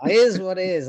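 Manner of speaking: lightly voiced cartoon sailor, high and loud; a hint of the cartoon sailor, loud; Popeye. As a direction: pitch up a cartoon catchphrase, lightly imitated. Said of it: "Popeye used to say".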